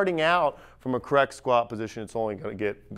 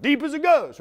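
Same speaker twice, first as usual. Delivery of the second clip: high-pitched mock protest; protesting older lifter; 11 semitones higher than usual. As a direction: imitate a stubborn lifter, pitched way up. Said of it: "And they're like".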